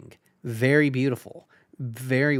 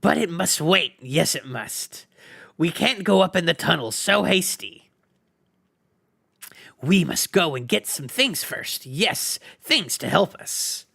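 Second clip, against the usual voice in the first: sly, stalling creature; wheedling excuse, hissed and crooked; Gollum voice; 3 semitones higher than usual. A narrator's reading.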